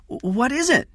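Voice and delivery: put-on high-pitched child's voice; high, startled question on waking. Jeff woken by Kathy, startled child.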